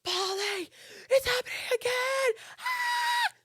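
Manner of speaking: very high fangirl squeal; high-pitched scream. Retold or imitated. Fan screaming to the DJ from front row, high-pitched squeal. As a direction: re-enact a shrieking fan losing her mind. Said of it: "at a high-pitched volume".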